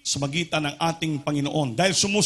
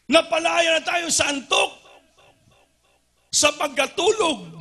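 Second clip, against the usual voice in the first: rousing preaching; 11 semitones higher than usual. Acted. Preacher waking the drowsy congregation, rousing call. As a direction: shake the sleepy crowd awake.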